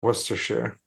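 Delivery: "Worcestershire" tried as "Wuss-ter-sheer"; copied British pronunciation. Retold or imitated. Friend trying the demonstrated word, British pronunciation.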